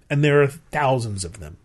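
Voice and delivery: ordinary talk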